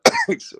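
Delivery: real cough breaking into the talk; choking cough. Real, not acted. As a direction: cough as if choking mid-sentence. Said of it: "sorry, I'm choking".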